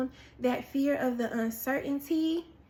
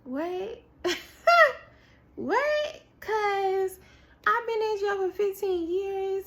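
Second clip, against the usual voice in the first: high-pitched, incredulous reenactment; shocked disbelief; 7 semitones higher, 4 dB louder than usual. Retold or imitated. Prisoner hearing of release after fifteen years, shocked disbelief. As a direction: act out being floored, voice leaping up.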